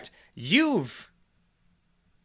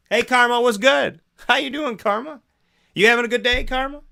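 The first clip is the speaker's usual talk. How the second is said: put-on high, loud, bubbly hello to an old friend; mock-chummy fawning over karma; cheery greeting; 7 semitones higher, 4 dB louder than usual. Speaker warmly greets karma like a buddy, high and loud.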